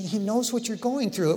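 ordinary delivery

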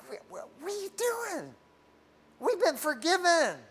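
high-pitched exasperated put-on voice; mock exasperation. Preacher mimics himself reacting to fretful believers, high and incredulous. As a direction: squeak it out in disbelief. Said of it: "It's like".

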